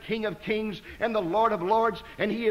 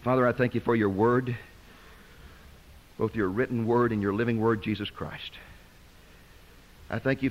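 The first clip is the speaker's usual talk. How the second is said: low, earnest pulpit prayer; closing prayer; 7 semitones lower than usual. Acted. Preacher prays for everyone here this evening, low and earnest. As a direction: pray over the people, low and unhurried.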